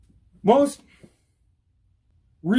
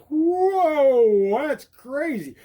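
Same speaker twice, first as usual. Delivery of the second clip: high, long whoa then quick reaction; hammed-up startled rider; mock alarm; 6 semitones higher, 4 dB louder, much slower than usual. Shown as a demonstration. Acts startled while demonstrating stop.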